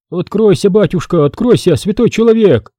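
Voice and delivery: put-on high, toothless old crone voice; an old woman begging at the door, lisping; mumbling old woman. A narrator's reading. Old woman knocks, calls the priest in a mumbling voice. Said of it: "Шамкающий голос".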